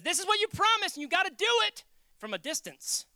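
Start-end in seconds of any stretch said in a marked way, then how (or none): 0.0-1.8 loud, high, insistent demand; forceful, pitched way up; demanding religion. Acted. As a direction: bark it as an acted ultimatum. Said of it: "Religion says".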